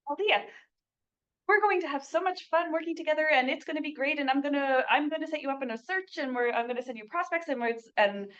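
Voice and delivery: high, gushing rush running into babble; mile a minute. Shown as a demonstration. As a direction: overwhelm the client with a high-pitched, breathless-paced pitch. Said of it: "going like that at a mile a minute".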